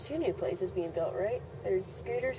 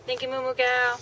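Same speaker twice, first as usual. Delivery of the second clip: raised, quicker playful call; higher cow call; 4 semitones higher, 4 dB louder than usual. Real, not acted. Cow isn't looking up, higher attention-getting call. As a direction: call out to get the cow's attention. Calling again as cow ignores her.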